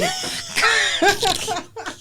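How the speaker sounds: shrieking laugh. Real, not acted. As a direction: cackle of delight. Laughing at own joke.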